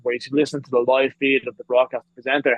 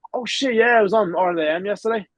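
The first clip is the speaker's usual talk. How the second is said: higher-pitched jolt of remembering; lightly acted "it just came back to me"; retold sudden realisation; 7 semitones higher than usual. Telling family he had forgotten being on TV, suddenly remembering.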